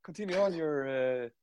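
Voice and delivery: very high, slow pleading voice; mock eager victim. Captive urging torturer to continue, very high and slow.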